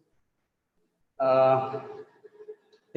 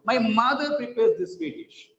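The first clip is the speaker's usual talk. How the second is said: higher pitch, emphasis on "mother"; stress on MOTHER; 7 semitones higher than usual. Shown as a demonstration. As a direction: insist on "mother", voice raised in pitch. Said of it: "not father".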